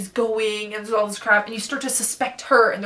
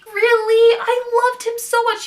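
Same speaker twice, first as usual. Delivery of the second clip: hurt disbelief; 10 semitones higher than usual. Real, not acted.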